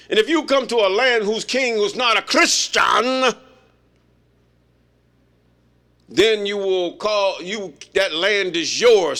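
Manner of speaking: booming, slowing toward the end like a decree; declaiming shout. Retold or imitated. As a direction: proclaim it as the pope's command. Quoting the pope's decree.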